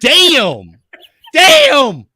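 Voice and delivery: high, loud, drawn-out "damn" of disbelief; stunned exclamation. Real, not acted. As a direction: react like you just watched a mic drop. Stunned reaction to the outburst.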